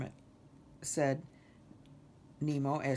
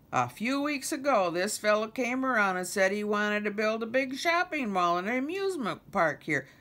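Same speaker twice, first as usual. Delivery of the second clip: higher, louder folksy explainer; lightly voiced Fergus; 6 semitones higher, 6 dB louder than usual. Fergus explains the mall plan to Kermit, lightly voiced neighbour. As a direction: lightly voice a chatty swamp neighbour.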